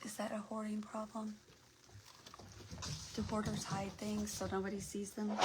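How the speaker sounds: normal talking voice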